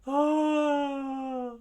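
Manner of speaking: long, loud falsetto scream; high drawn-out crowd cry; acted scream. Crowd keeps screaming in battle.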